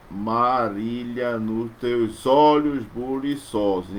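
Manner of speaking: slow, with the stress on "olhos"; slow verse reading stressing "olhos"; scansion reading. Shown as a demonstration. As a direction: lean on the O of "olhos". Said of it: "a sílaba forte está no O de olhos".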